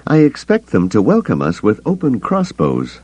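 a curt, pointed comeback; sharp retort. A narrator's reading. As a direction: snap back at him with dry scorn. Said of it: "Mara retorted".

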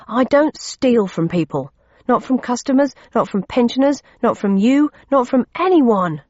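rising angry protest; indignant anger. A narrator's reading. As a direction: hammer each denial home. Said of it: "growing angrier by the second".

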